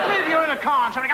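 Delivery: ordinary talk, nothing marked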